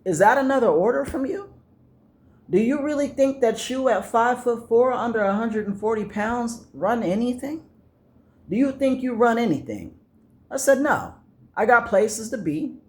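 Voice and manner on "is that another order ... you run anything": high, incredulous taunting; mocking retort. Comic mocking the short rival, high and incredulous. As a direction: belittle him with questions. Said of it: "And I was like".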